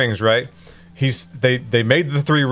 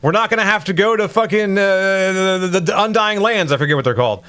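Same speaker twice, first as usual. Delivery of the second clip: lightly voiced high elf king, higher and earnest; a hint of a pompous elf king, pitched up; mock elf king; 6 semitones higher than usual. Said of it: "And High Elf King says".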